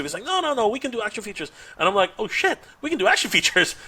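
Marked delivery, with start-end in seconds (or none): 0.2-1.5 high, eager insistence; retold excitement. Colleague insisting the features are possible, excitedly. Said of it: "We all started getting excited". 2.2-3.8 high, laughing amazed realization; retold excitement. Realizing action features are possible, excited and amazed. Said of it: "We all started getting excited".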